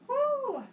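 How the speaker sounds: high cheering woo; excited whoop. Real, not acted. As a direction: whoop to fire up the class. Cheering class mid workout.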